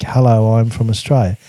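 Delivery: ordinary delivery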